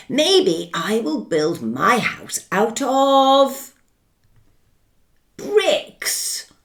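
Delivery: lightly voiced careful little pig, musing; a thoughtful pig weighing his options; third little pig.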